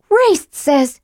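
high mocking mimic; mocking imitation. A narrator's reading. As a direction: mimic him with scorn. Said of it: "Tika mimicked him".

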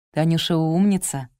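usual speaking voice

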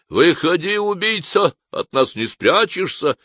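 rough soldiers calling him out; soldiers shouting. Acted. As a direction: bark it out at the hiding man. Soldiers shouting at hidden man.

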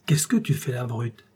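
usual speaking voice

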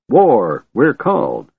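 high, rousing battle call; rally the troops with a trumpeting call; trumpeting cry. A narrator's reading. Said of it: "he trumpeted".

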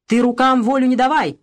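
громко выкрикнуть, выше; резко крикнуть на мать; крик. Acted. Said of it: "крикнула Анна". (Анна кричит на мать, замахнувшуюся шайкой)

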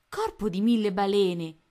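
loud, bright exclamation of surprise; exclaimed oath. A narrator's reading. Tony sees a hidden ship, loud exclamation. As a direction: blurt it out with a burst of wonder. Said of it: "esclamò Tony".

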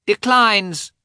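high, loud youthful shout; squeaky shout. A narrator's reading. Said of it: "shouted a youthful, squeaky voice".

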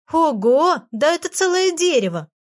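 громче и выше, восторженное «Ого»; ахни от восторга при виде розы; восторженно воскликнув. Acted. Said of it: "воскликнула Фенечка". (Фенечка восхищена розой: громкое восклицание)

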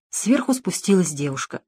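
ordinary delivery

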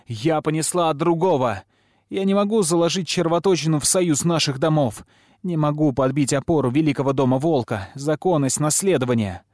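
loud, high declaration. A narrator's reading. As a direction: raise your voice and announce it. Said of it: "повышая голос, заявляет". (громко, повышая голос)

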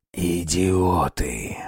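hissed furious whisper; angry whisper. Acted. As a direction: scold them in a whisper. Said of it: "Почему-то шёпотом напустился он на них".

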